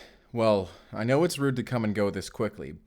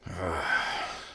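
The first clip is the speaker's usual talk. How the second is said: sigh; 6 semitones lower, 5 dB quieter than usual. Acted. Grudgingly lowering his gun.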